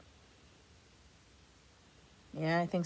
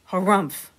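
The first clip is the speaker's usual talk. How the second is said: grumpy harumph; 6 dB louder than usual. Acted. Walrus answering.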